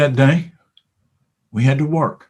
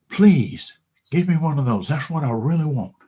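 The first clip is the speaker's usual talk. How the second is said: normal talking voice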